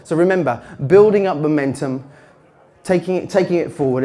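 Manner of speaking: usual speaking voice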